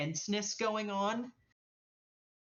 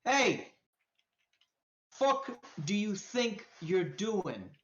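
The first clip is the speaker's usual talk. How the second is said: menacing challenge; 3 semitones higher, slower than usual. Acted. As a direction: confront them slowly, word by word. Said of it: "And he's like".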